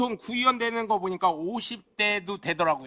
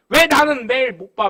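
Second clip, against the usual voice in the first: louder, demanding question; mock scolding; 4 semitones higher, 9 dB louder than usual. Voter grilling the councilman about his absence, loud demand. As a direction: press him hard.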